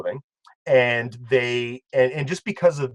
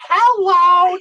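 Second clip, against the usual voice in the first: very high, loud truck jingle voice; high mock yell of a recorded hello; imitated truck yell; 9 dB louder than usual. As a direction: mimic the truck's piercing holler. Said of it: "every time it made that sound".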